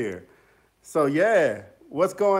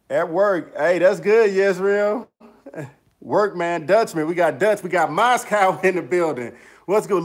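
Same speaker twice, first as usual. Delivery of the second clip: normal talking voice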